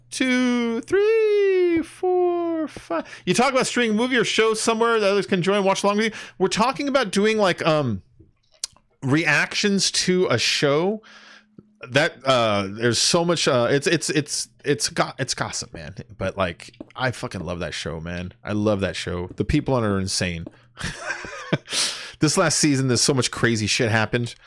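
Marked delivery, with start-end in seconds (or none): 0.1-3.1 counting up in a squeaky high falsetto; high mock-squeaky counting, sliding down on each number. 20.8-22.1 airy giggle way up high; breathless high-pitched chuckle; high breathy laugh. Real, not acted. Laugh after show remark.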